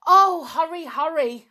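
high, loud, urgent; will them to hurry; the reader caught up in the suspense, pleading loudly; urgent excitement. Real, not acted. Narrator urging in suspense.

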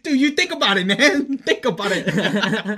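laughing through a quote; giggling while imitating friend.

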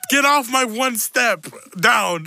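slow, loud, high hammed-up shout; mock shout. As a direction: yell it like guarding a tiny porch. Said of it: "he's like".

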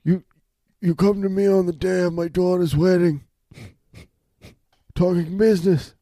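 lightly raspy mafia don; a hint of a Godfather boss; mob boss voice. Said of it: "This is not a mobster accent".

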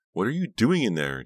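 high, loud demand; astonished, insistent questioning. A narrator's reading. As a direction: demand an explanation, pitch swooping up. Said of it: "Jade demanded".

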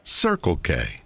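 slow, low "Circle K" leaning on CIRCLE; exaggerated first-word stress. Shown as a demonstration. Co-host demonstrates the emphasis to her, "Circle K" slowly front-stressed. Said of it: "the emphasis on the other syllable".

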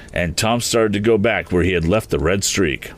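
ordinary delivery, nothing marked

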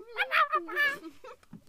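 high, loud cackle like a chicken; chicken laugh. Shown as a demonstration. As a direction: laugh like a squawking hen. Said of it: "I laugh like a chicken".

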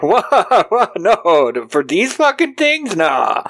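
high-pitched, hammed-up scoffing; mock incredulous refusal. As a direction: leap up in pitch as if appalled, then dismiss it. Official refusing request, acted out.

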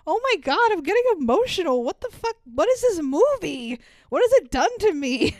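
high, fast flustered disbelief; mock shock. Host retells tearing up at the movie, high and flustered. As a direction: play up being caught off guard. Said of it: "and I'm like".

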